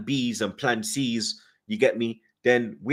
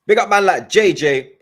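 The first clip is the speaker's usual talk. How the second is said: higher, louder hype call to a viewer; big it up like you're calling across the room; hyped shout-out; 8 semitones higher, 9 dB louder than usual. Real, not acted. Shout-out to a chat member.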